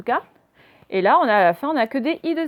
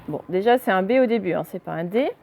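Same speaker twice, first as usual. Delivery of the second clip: ordinary talk, nothing marked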